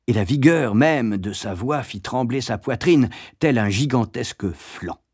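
plain reading voice

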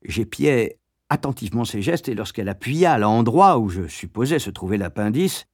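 usual speaking voice

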